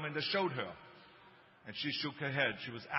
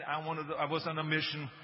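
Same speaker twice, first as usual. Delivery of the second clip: ordinary talk, nothing marked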